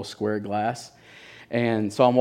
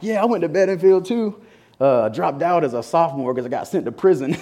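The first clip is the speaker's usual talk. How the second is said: higher, easygoing inmate voice; a cheerful ex-con chatting; friendly inmate; 6 semitones higher, 3 dB louder than usual. Retold or imitated. Inmate telling him he dropped out for prison, cheerfully. Inmate talks about school.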